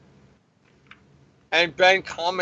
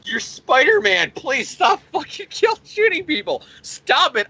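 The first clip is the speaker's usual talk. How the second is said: high-pitched, hammed-up hysterical mother; mock tearful pleading; 10 semitones higher than usual. Shot mother begging Spider-Man to stop shooting, mock hysterical pleading. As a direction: plead in a mock-distraught high voice, piling up complaints. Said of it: "'cause then she's crying".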